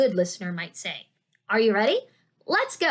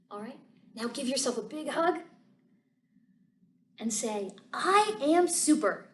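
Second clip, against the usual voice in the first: soft affirmation voice; 6 dB quieter than usual. Acted. Guided affirmation.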